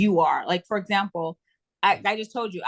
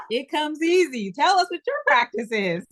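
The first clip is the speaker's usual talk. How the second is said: higher-pitched playful mimicry; mock-impressed, pitched up; playful teasing; 7 semitones higher than usual. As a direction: tease her with a high, lilting quote. Playfully putting co-host on the spot.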